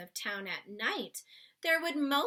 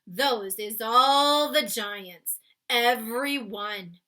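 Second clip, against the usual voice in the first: slow, louder giant announcing; 12 dB louder, slower than usual. Acted. Giant showing Sophie the land of giants. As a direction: present it grandly. BFG voiced in read-aloud.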